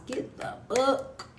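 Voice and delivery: slow breathy whisper of the plug. Real, not acted. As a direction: whisper it slowly, like a secret. Whispered sales pitch.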